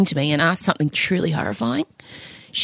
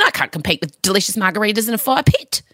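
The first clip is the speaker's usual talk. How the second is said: ordinary talk, nothing marked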